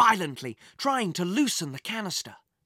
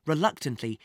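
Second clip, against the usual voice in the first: usual speaking voice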